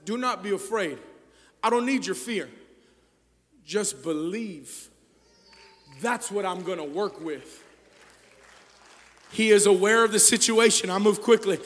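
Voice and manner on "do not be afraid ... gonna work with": soft, low, gentle divine voice; quiet reassurance. Acted. God softly reassuring the fearful believer. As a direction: speak it softly to comfort. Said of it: "God says".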